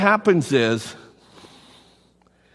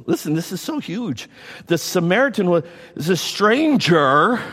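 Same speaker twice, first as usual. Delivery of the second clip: normal talking voice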